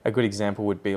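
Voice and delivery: ordinary talk, nothing marked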